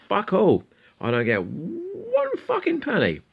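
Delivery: FUCKING pushed up high and drawn out; "one fucking penny" stretched out in high-pitched exasperation; emphatic protest. Real, not acted. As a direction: hit "fucking penny" in a high, slow squeal. Protesting that he earns nothing.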